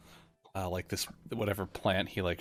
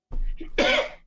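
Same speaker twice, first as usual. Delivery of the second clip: wheezy cough; 6 dB louder than usual. Acted. NPC coughs again.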